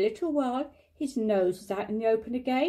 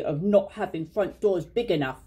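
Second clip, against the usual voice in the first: grumpy and lower, a touch sulky; cross; 5 semitones lower than usual. A narrator's reading. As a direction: mutter it crossly, lower pitch. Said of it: "said Pooh crossly".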